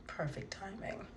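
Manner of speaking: a sly whispered perfect timing; whispered aside. Retold or imitated. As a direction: whisper it to yourself, plotting. Scheming aside during phone call.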